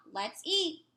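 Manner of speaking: high, loud call to the table; grandma calling out. Acted. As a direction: call everyone to dinner, lifted and bright. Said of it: "When my grandma calls out".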